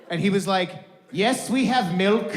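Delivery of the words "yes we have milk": put-on slow, higher Arabic-accented reply; Egyptian shopkeeper.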